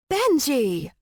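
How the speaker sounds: bright high chirp of a nickname; cheerful nickname. A narrator's reading. Streep teasing the lion with a nickname, chirpy. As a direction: sing out Benji playfully. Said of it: "Streep cheered".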